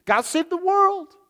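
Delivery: high, lofty mock proclamation; mock grandiose. Preacher mocking a too-busy savior, lofty mock tone. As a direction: puff it up like a self-important savior.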